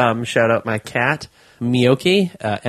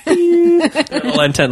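laughing; 4 dB louder, much slower than usual. Real, not acted. Laughing while talking.

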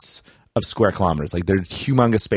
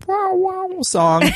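high sung wobble on wow wow; playful high imitation of a warbling synth; wobbly song imitation; much slower than usual. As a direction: mimic the wobbly bass line, way up high.

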